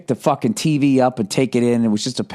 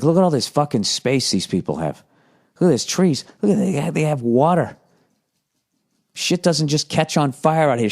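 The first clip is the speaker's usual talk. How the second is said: envious wonder; 6 semitones higher than usual. Retold or imitated. Envious of Nebraska's space, quoting self.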